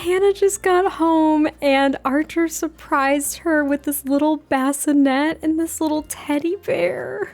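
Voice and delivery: high, slow, drawn-out cooing; tender, high-pitched gush. Real, not acted. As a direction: melt over something adorable. Gushing over a baby gift.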